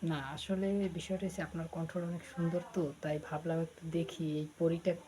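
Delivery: normal talking voice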